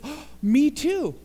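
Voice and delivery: overjoyed me too. Acted. Man on the bridge overjoyed at another match, high and loud. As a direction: gush it out with joy.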